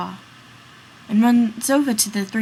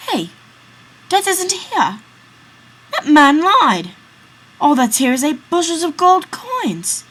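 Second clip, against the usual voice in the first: high, loud and slowed exclamation; excited discovery; 7 semitones higher, 7 dB louder than usual. Acted. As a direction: cry out in amazed excitement. Friend discovers gold, not Death.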